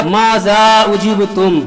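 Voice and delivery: very slow recited ماذا اجبتم, drawn out; Quranic recitation. Acted. Preacher quoting Allah's question to the prophets, slow recitation. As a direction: intone ماذا اجبتم slowly and gravely.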